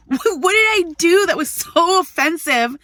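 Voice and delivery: high, loud exasperated retelling; indignant protest. Retold or imitated. Woman challenges man photographing her, loudly indignant. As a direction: act out outraged disbelief.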